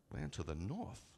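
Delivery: "land to the north" dropped low and soft; soft aside repeating "north" with a rise; quiet repeat. Shown as a demonstration. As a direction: murmur "land to the north" back to yourself.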